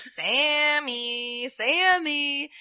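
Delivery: drawn-out, singsong taunting call; calling someone out. Retold or imitated. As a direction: imitate hunting him by name, stretched out. Retold man calling out in woods.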